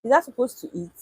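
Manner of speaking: ordinary talk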